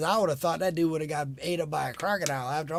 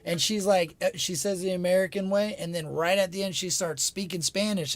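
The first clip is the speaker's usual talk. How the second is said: normal talking voice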